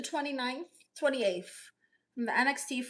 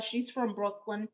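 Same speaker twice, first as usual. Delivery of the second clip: ordinary talk, nothing marked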